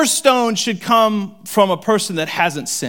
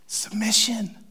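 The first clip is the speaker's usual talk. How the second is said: whispered; 4 semitones higher, 4 dB quieter, slower than usual. Real, not acted. Whispered word.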